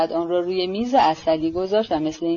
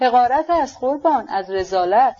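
ordinary delivery

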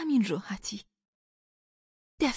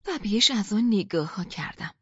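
ordinary delivery, nothing marked

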